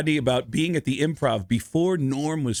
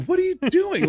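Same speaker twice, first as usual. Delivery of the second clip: much higher-pitched imitation of an aghast bride; protesting bride. Bride in her wedding dress to her groom, high mock protest.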